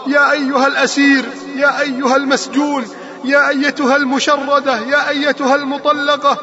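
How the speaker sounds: rousing repeated call to the congregation, pitched above the calmer talk; impassioned preaching. Real, not acted. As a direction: urge the whole crowd to cry out with you, insistent and ringing. Rousing litany calling on listeners. (وعظ حماسي بنداء متكرر)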